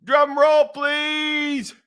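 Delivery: high, loud, long-held last word; show announcer. Pretend. Host bringing on his guest Billy, announcer call. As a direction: call for it like an emcee.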